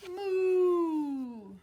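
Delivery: loud mooing moo; cow moo. Shown as a demonstration. Teacher inviting the child to moo, loud moo. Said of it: "Can you say".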